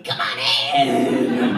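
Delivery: very low, loud booming invite; little transgender voice. Retold or imitated. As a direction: drop way down and boom it out. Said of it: "with my little transgender voice going".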